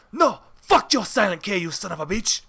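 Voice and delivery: mock-indignant Spanish accent; imitated Spaniard's accent, defiant; David. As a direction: curse them in a put-on Spanish accent. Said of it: "that is how fucking David spoke".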